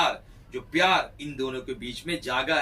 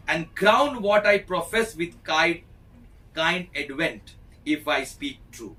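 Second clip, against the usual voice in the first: slow, grand and a bit louder, each word weighed; dramatic Shakespeare recitation; 2 semitones higher, 3 dB louder, much slower than usual. Acted. Teacher reading Ferdinand's vow aloud to the class, slow and grand. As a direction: intone the vow like a stage lover.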